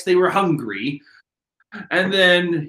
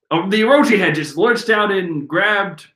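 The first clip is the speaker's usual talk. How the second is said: ordinary talk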